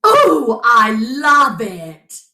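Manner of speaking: exclaiming fox. A narrator's reading. Fox admiring his new gold tooth, delighted gushing. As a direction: savour each word, thrilled with the gift. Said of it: "exclaimed the fox".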